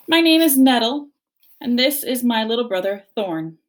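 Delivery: loud, mock-polite bear; smug bear introduction; sister bear voice. A narrator's reading.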